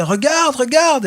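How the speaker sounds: high, urgent "Regarde, regarde!"; crowd calling out. Retold or imitated. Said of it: "les gens disaient".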